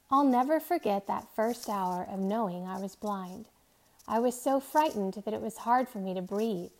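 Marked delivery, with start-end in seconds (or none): none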